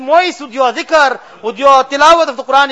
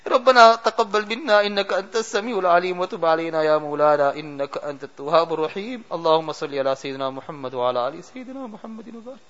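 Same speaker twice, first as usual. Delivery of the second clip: fast, fading Arabic closing formula; rapid closing recitation; 13 semitones lower, 12 dB quieter, much faster than usual. Real, not acted. Imam closing the dua with rapid Arabic verses. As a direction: murmur the closing verses quickly as the mic fades.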